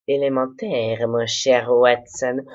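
slow, grand detective pronouncement; lightly hammed-up detective line; famous detective. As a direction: put on a slow mock-detective voice, savouring each word.

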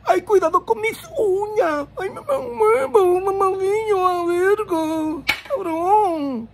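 high, whiny put-on voice, half crying; mocking Fey with a high whining cry. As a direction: camp it up with a fussy whimper. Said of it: "Fey dice".

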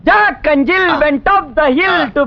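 very slow, stretched-out shouted recitation; declaimed rhyme. Acted. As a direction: proclaim it grandly, word by word. Recites rhyme as an American proverb.

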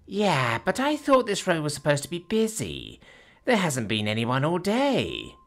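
high-pitched whining complaint. A narrator's reading. Friend moaning about the empty road, whining. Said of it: "Your whining".

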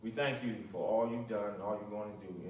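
ordinary delivery

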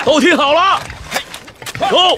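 high-pitched call to the diggers; rally the men like a drill leader; shouting slogans. Acted. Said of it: "你现在都会喊口号了". (高声喊口号给大家鼓劲)

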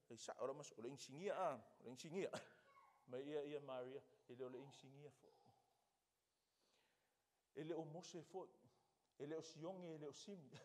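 very soft, as if Joseph thinks aloud; almost to himself; quiet brooding murmur; hushed inner voice. Acted. Imagined thoughts of Joseph.

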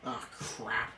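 ordinary talk, nothing marked

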